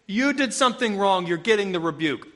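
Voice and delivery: ordinary talk, nothing marked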